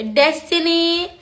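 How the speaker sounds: high, drawn-out name; delighted calling out. Real, not acted. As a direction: sing out the name in greeting. Spots another friend among viewers.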